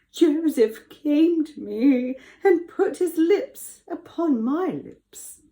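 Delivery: high, slow, tearful put-on whine; mock weeping. A narrator's reading. Wife lies to her husband about Joseph, mock weeping. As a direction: whine it out in fake tears. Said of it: "weeping and wiggling her lips".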